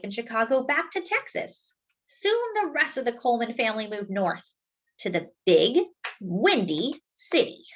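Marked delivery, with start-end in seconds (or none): none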